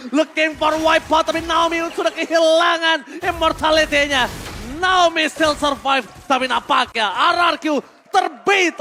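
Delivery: fighting game announcer. Real, not acted. Caster calls the teamfight.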